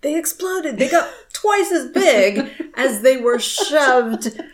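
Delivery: laughing mid-sentence; chuckling while telling it. Real, not acted. Laughing at exploding bags.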